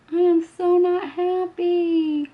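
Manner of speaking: high, loud, slow whiny complaint; milk the disappointment like a sulky diva; drawn-out, pouty whine; hammed-up pout. Dramatic complaint about makeup.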